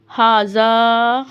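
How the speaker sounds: हाजा with both vowels drawn long; lengthened vowel. Shown as a demonstration. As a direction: hold the final aa of हाजा. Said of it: "लंबा करना है आपने इसको".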